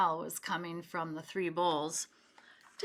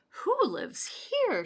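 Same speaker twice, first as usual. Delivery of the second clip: high, slow wondering question; high slow curious girl voice; 13 semitones higher, 4 dB louder, slower than usual. A narrator's reading. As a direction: ask it slowly in wide-eyed wonder. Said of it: "she wondered".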